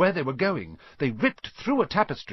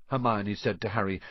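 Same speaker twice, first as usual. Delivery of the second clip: usual speaking voice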